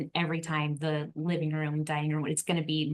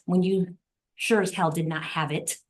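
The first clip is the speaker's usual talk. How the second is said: ordinary talk, nothing marked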